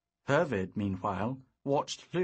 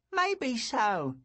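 put-on high wry young boy; a cheeky boy; 11 semitones higher, 3 dB louder than usual. A narrator's reading. Boy Lou voiced.

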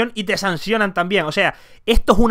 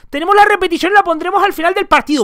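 high, louder prissy official voice; mocking official; 10 semitones higher, 6 dB louder than usual. Mocking the referees' announcement in a high put-on voice. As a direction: parody the smug referees. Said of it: "pero te dicen".

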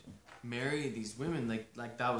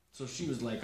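plain reading voice

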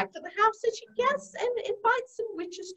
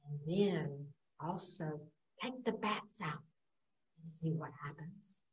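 soft whisper; 13 semitones lower, 16 dB quieter than usual. Acted. Owl whispers.

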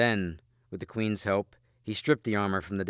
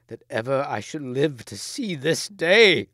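high, loud swelling cry; anguished lament; 5 semitones higher, 7 dB louder than usual. A narrator's reading. Gawain lamenting the queen's fate, anguished. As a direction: build to an outcry of grief.

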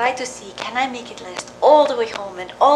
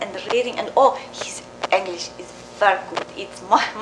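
normal talking voice

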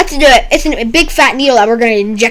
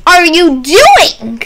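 drawn-out startled yell, much higher and louder; loud slow shout; 7 semitones higher, 7 dB louder, much slower than usual. Acted. Doctor yelling at patient in game, slow loud shout. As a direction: stretch it out in alarm.

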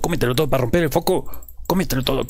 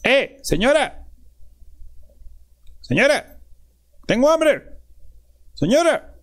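calling out; 12 semitones higher, much slower than usual. Real, not acted. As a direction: shout for the lady. Calling out to the lady.

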